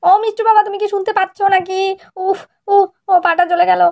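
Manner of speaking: mock-injured wife calling out; pretend pain cries. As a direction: fake a bathroom fall to lure husband. Wife keeps faking injury.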